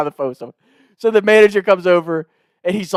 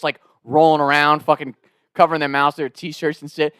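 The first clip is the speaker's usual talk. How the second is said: usual speaking voice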